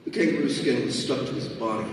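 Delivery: plain reading voice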